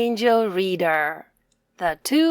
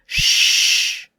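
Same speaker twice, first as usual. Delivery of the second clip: shush; 5 dB louder than usual. Acted. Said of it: "said the rabbits".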